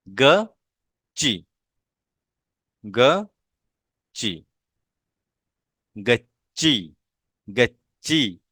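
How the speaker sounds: गच्ची drawn out very slowly, syllable by syllable; slow syllable reading. Shown as a demonstration. Teacher slowly sounding out गच्ची for pupils. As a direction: say गच्ची slowly so the double च is heard. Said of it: "teacher sounding out गच्ची".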